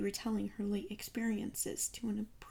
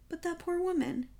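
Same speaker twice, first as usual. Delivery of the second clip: much higher-pitched, dismayed objection; pleading protest; 8 semitones higher, 3 dB louder than usual. A narrator's reading. Friend pleads with Poirot for the robbed wife, protesting. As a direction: protest on her behalf.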